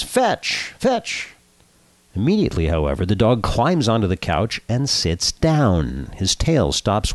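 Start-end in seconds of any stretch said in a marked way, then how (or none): 0.0-1.3 sharp, high-pitched command to a dog; high commanding call. A narrator's reading. Morris showing off his dog to a friend, high commanding call. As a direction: call the dog like an eager owner. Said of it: "and commands".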